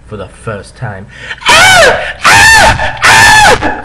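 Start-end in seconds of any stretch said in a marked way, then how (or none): 1.4-3.8 very high shrieking "Ah"; hammy screams. Acted. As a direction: scream it over the top. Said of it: "I screamed".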